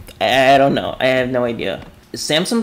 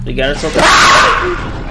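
laughing scream; 4 dB louder, slower than usual. Real, not acted.

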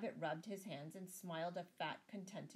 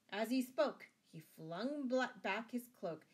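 plain reading voice

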